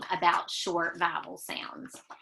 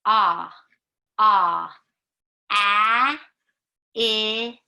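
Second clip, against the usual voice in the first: loud short vowel chant, much louder; alien talk; 8 dB louder, much slower than usual. Pretend. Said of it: "You ready?".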